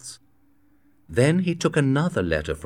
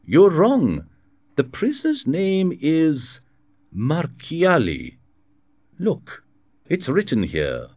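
raised and louder, slowing on the name Marchiali; insistent Aramis; 8 semitones higher, 4 dB louder, slower than usual. A narrator's reading. Aramis insists to the governor on the prisoner's name, raised and firm. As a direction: correct him firmly, pointing at the page.

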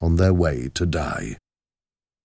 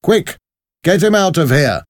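high, quick urgent order; pressing, raised-voice command; urgent call; 12 semitones higher, 5 dB louder than usual. A narrator's reading. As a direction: call out, hurrying someone away. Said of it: "he called".

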